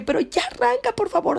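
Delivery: high, desperate begging, near tears; desperate plea. Retold or imitated. Begging her sister to start the car, desperate. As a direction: plead as if close to crying. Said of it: "me vio tan desesperada".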